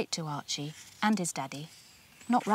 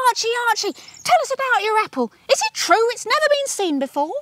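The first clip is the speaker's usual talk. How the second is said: high, loud reporters calling out; shouting reporters; 14 semitones higher, 5 dB louder, faster than usual. A narrator's reading. Reporters shouting questions at Archie, high and loud. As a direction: call out eagerly over the crowd.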